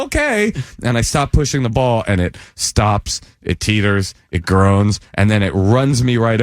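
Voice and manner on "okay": high, meek agreement; high meek okay. Retold or imitated. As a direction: give in meekly. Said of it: "I go".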